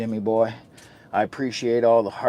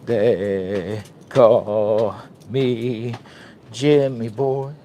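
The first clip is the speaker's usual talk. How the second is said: very slow, stretched persona announcement; very slow put-on voice; 3 dB louder, much slower than usual. Announcing himself to viewers in a very slow, stretched put-on voice. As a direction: draw every word out like a stage intro. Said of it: "What is wrong with his voice?".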